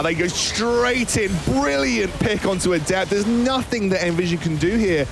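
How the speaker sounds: high, urgent casting of the fight; excited play-by-play. Acted. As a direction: call the action with rising excitement.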